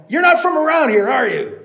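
loud, very high; calling. Acted. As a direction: call it up. Landowner calling up.

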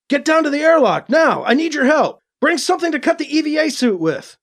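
high-pitched, hurried orders; urgent call. A narrator's reading. Hero trapped in airlock to crewmate, urgent calling. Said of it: "Alibi called over the comm".

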